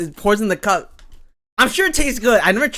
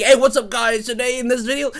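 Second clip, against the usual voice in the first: put-on YouTuber accent; 6 semitones higher, 4 dB louder than usual. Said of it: "that's, like, the, the accent".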